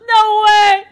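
can't believe his luck; thrilled cry. Real, not acted. Delighted disbelief in game.

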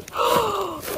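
sharp intake of breath at a find; surprised gasp. Real, not acted. Seeing the unwrapped goods.

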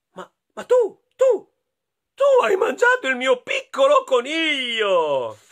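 high, loud startled stammer; astonished doctor. Acted. Doctor realising the wolf ate his bunny, loud and high. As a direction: splutter it in shock. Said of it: "il dottore esclamò".

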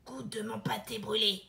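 hushed stove voice; whispering old stove. Acted. Stove speaks.

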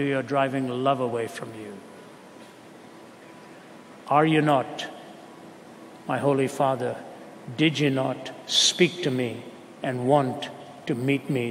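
normal talking voice

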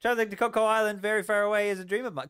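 high, loud excited outburst; excited shouting. Real, not acted. Streamer excited about a dream island trip, shouting. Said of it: "I'm shouting a lot today".

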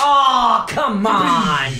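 loud, high protest; frustrated shout. Real, not acted. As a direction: yell it in frustration. Reacting to a bad roll.

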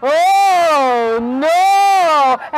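loud, very long moan; moaning bear. A narrator's reading. A hundred bears going through the forest, moaning. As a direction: swell and swoop on each word. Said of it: "he was moaning".

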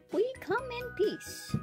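high alien greeting; little alien. Pretend.

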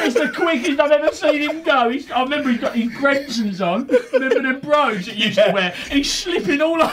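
high, giggly storytelling; real laughter breaking through the words; laughing while telling. Real, not acted. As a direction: tell it while cracking up.

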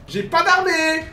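high, slow, loud wail; despairing shout. Real, not acted. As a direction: wail it in disbelief. Realises he has no army.

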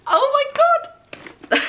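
very high, loud gasping squeal. Real, not acted. Fan squealing at the glowing lamp. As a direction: squeal in delight.

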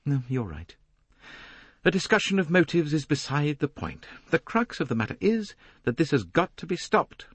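high-pitched woman pressing her point; high, insistent character voice; quick, flustered lady. A narrator's reading. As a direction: brush it aside, then insist. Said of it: "and she said quickly".

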